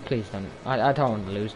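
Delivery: ordinary delivery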